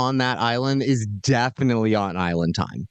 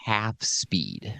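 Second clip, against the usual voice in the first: slow and much softer, stretched out; dragging half speed; 6 semitones lower, 7 dB quieter than usual. Shown as a demonstration. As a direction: let it crawl lazily at half speed. Acting out the slow pace.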